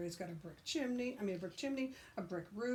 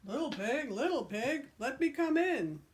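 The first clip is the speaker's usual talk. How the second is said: wolf calling at door; 5 semitones higher, 6 dB louder than usual. Acted.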